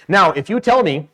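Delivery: usual speaking voice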